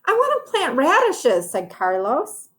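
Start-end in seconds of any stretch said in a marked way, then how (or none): 0.0-1.5 put-on high, bright little boy voice; high child voice; Carlos's voice. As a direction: pipe up like an eager kid. Carlos voiced in picture book.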